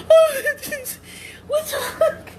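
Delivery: high, weepy words through laughter; laughing to tears. Real, not acted. Woman ending her video after the bird scare, laughing to tears. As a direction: gasp it out while cracking up.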